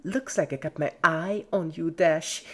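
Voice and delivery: loud, high, gloating tease; creepy cheerful Pinkie. A narrator's reading. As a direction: taunt her friend playfully.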